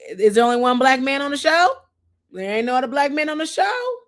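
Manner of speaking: high, rising pitch of disbelief; incredulous. Real, not acted. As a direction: push it up toward the end, incredulous. Incredulous question to the audience about cast.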